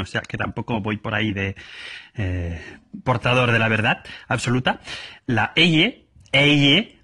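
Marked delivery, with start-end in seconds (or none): 5.3-6.9 "eye" pronounced carefully, higher, rising; demonstrated elle sound. Shown as a demonstration. Madrid speaker contrasting elle with Y, careful pronunciation. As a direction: stress "eye" twice, lifting the pitch. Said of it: "no se pronuncian igual".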